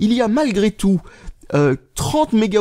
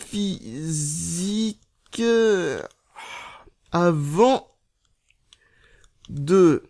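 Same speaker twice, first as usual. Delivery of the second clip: drawn-out syllables, long gaps; typing aloud; 3 dB quieter, much slower than usual. Real, not acted. Vlogger typing on a mini keyboard, halting dictation. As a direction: spell it out slowly as you type.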